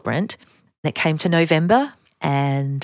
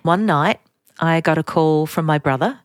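ordinary talk, nothing marked

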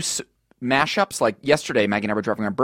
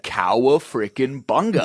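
a slow, lightly drawled surfer-dude Cowabunga; lazy California surfer, understated; Southern California surfer; slower than usual. Pretend. Mocking the Southern California accent in a light surfer voice. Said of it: "it is like the Teenage Mutant Ninja Turtles".

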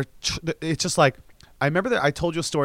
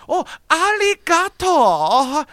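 much higher, louder and slow, a put-on Japanese accent; overdone tourist Japanese; 7 dB louder, much slower than usual. Tourist greeting a local in a hammed-up Japanese accent. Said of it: "they do it like that".

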